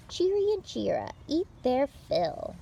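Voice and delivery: ordinary delivery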